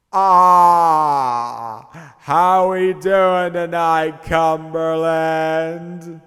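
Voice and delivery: loud, drawn-out stage greeting; frontman on the mic. Acted. Band's lead guy greeting Cumberland, loud showman drawl. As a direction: greet the crowd, long high held vowels. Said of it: "He grabbed the mic and said".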